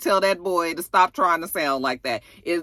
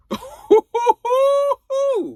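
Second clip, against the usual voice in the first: long high "ooh" of scandal; drawn-out, loud teasing whoop; gleeful hoot; 5 dB louder than usual. Real, not acted. Teller's gleeful hoot at gossip.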